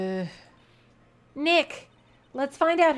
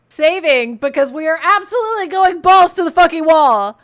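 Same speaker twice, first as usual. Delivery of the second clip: loud, hyped yell, higher and much louder; excited shout; 5 semitones higher, 12 dB louder than usual. Real, not acted. Player hyping the big courtroom accusation, excited shout.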